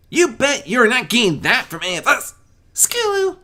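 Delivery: high, loud filly voice cutting in; interrupting. Acted. Sweetbelle cutting in on Skulu, high and loud. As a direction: cut in sharply. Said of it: "Sweetbelle interrupted".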